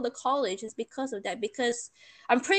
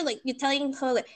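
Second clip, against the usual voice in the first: normal talking voice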